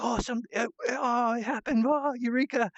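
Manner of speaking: high-pitched, jumbled delighted exclamations; really happy. Acted. Acting Pan and Millie getting it, really happy. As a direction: burst out in overjoyed discovery. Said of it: "so they're really happy".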